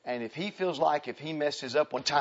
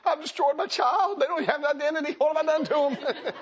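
distraught parent; 13 semitones higher, 4 dB louder than usual. Retold or imitated. Homeschooling mom lamenting to herself, high loud mock despair. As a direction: wail in hammed-up parental guilt. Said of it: "And then you're going".

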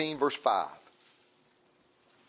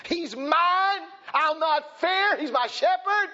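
much higher, loud proclaiming cry; bold shout; 12 semitones higher, 5 dB louder, slower than usual. Acted. Preacher shouts the believer's bold stand to the congregation. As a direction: storm it out with bold faith. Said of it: "take your stand and say".

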